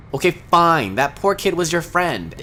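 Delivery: ordinary talk, nothing marked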